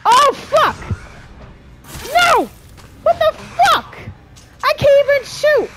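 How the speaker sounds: very high, loud frantic screams; panicked yelling. Real, not acted. Player whose gun fails mid-attack, panicked screaming. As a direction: scream it in sudden helpless panic.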